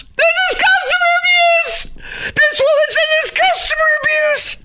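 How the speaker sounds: very high, hammed-up sobbing shriek; mock crying wail. Host mocking woman's abuse headline, crying wail. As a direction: wail it like an outrageous injustice.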